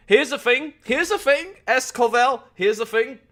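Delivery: high-pitched mock British catchphrase; put-on British accent. Streamer teases chat with a catchphrase, high mock British accent. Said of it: "have an accent when you're in your basement".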